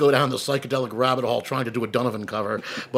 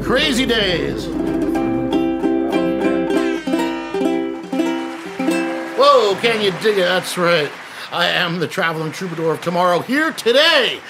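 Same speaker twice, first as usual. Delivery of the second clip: 1940s jive talker. Acted. Host's hip jive intro.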